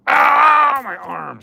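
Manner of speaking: sudden high, loud yelp of real pain; pain outcry. Real, not acted. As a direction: cry out as the arm hurts. Sudden pain while gesturing.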